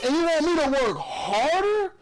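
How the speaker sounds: high, wounded protest; hurt disbelief. Real, not acted. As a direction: throw it back in hurt disbelief. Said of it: "the hurt in his voice".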